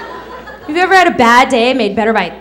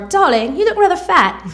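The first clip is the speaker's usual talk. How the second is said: put-on English accent; 7 semitones higher than usual. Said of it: "Accent disclaimer".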